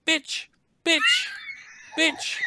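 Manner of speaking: extremely high shrieking insult; squeaky creature. Acted. Caged creature insulting the player, shrill shriek. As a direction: shriek it like a tiny beast. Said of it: "look at you and say".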